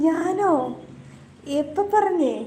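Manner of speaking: high, indignant "who, me?"; surprised denial. Real, not acted. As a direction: protest your innocence, pitch jumping up. Denies being accused of crying. (ഞെട്ടലോടെയുള്ള നിഷേധം)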